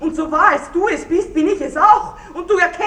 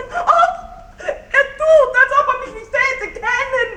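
laughing through tears, pitch leaping up; half laugh, half sob at his denial; hysterical laugh-sob; 6 semitones higher than usual. Acted. Spurned widow, laughing and crying. (Witwe verzweifelt, lachend und weinend zugleich)

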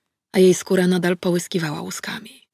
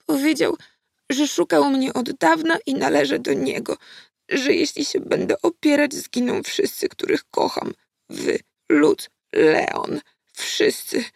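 put-on higher, halting tearful child voice; stammering, sniffling; 7 semitones higher than usual. A narrator's reading. Frightened girl explains the demon's threat, stammering and sniffling. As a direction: stumble through it, sniffing back tears. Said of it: "wydukała, pociągając nosem".

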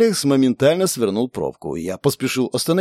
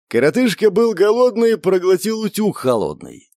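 higher-pitched sing-song taunt; teasing jibe; 7 semitones higher than usual. A narrator's reading. Napalm ribs his hungry friend with a rhyme, teasing. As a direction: needle him with the silly rhyme. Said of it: "Не удержался от подначки Напалм".

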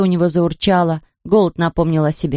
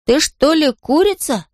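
careful question, slow and higher; cautious; 8 semitones higher, slower than usual. A narrator's reading. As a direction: feel it out slowly, pitch lifted. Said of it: "осторожно спросил он". (осторожно, с расстановкой)

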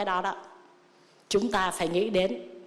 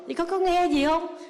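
hammed-up, higher, blissful layperson voice; mock follower; 7 semitones higher than usual. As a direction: play the smug devotee who skips the teaching. Monk mocks a contented follower.

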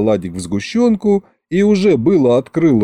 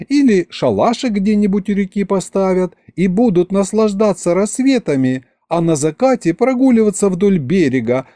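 put-on high, girlish swooning voice; dreamy romantic vampire girl; 7 semitones higher than usual. A narrator's reading. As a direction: get carried away by a romantic fantasy, pitched high.

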